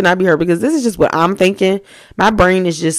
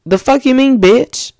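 indignant and louder; loud outburst; 5 semitones higher, 5 dB louder than usual. Shown as a demonstration. Showing how she really felt about the girl, loudly. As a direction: throw it out with outrage.

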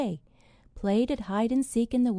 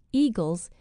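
plain reading voice

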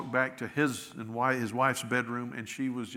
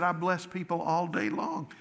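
higher, insistent self-defence; listing his good deeds, pitched up; indignant protest; 6 semitones higher than usual. Retold or imitated. Man defending himself to the Lord, higher and insistent.

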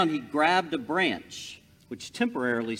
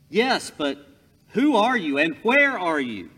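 anxious call; 5 semitones higher, 8 dB louder than usual. Acted. Jack asks the unseen voice.

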